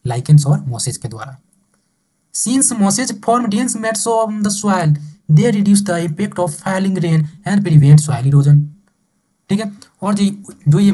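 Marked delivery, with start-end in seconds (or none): 2.3-8.6 very slow, drawn-out textbook reading; slow read-out from the book; reading aloud. Acted. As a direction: stretch every word as if dictating. Teacher reads textbook line aloud.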